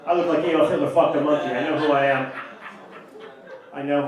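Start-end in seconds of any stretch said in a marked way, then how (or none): none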